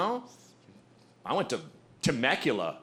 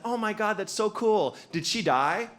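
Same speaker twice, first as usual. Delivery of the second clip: gushing partygoer; 7 semitones higher than usual. Retold or imitated. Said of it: "She's like".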